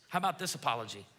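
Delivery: usual speaking voice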